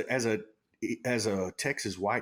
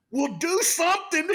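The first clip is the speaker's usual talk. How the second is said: much higher, louder half-laughing yell; laughing shout; 5 dB louder than usual. Retold or imitated. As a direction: yell it at him while laughing. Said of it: "And R C goes".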